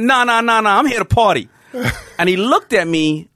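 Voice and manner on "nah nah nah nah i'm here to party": higher, louder, dismissive; mock Mike Tyson brush-off. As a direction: wave him off like a partying champ. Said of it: "And he said".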